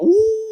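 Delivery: high-pitched mock wail; imitated crying wail. Father-in-law kissed for the first time, mock crying wail. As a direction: mimic someone bursting into tears. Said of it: "terus teriak".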